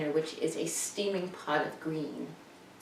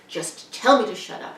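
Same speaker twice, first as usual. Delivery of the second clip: louder mock mother; mother's retort; 5 semitones higher, 8 dB louder than usual. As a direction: fire it back like a sulky mother. Mother's replies retold.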